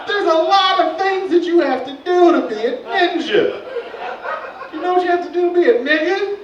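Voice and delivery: high, loud, laughing disbelief; exasperated comic rant, pitched high; incredulous rant. Real, not acted.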